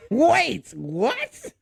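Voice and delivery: high, loud disbelieving outburst; incredulous reaction. Retold or imitated. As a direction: blurt it out in baffled disbelief, breaking into laughter. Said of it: "I'm like".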